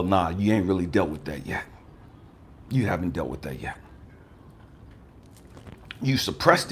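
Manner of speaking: a hint of God quietly setting him straight; God's voice. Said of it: "God has a way of slapping you with it and just to let you know".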